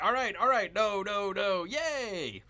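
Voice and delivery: high, loud imitation of himself celebrating; hammed-up cheering. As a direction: act out anxious waiting bursting into a mock cheer. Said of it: "I'm like".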